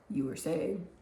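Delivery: low, soft, composed question; calm after the outburst, voice dropped; imitated eerie calm. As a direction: ask quietly as if nothing happened. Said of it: "Then he came calmly back".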